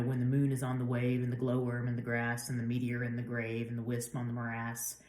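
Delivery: low, soft, even verse reading; poem recitation. Acted. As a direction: recite it quietly in a level chant-like rhythm.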